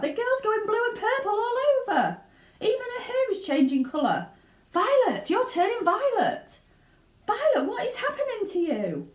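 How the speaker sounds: very high, loud frantic cry; near-hysterical panic; hysterical mother. Acted. Mother cries out as Violet turns violet. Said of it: "yelled Missus Beauregard".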